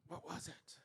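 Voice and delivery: sudden very soft question; hushed suspense. A narrator's reading. Storyteller asks what the noise was, hushed. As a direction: drop right down and ask it secretively.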